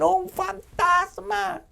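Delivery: very high, slow, stretched-out cry of terror; frightened scream. Acted. Disciples seeing a figure on the water scream in terror. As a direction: wail it like terrified sailors. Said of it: "ellos gritan".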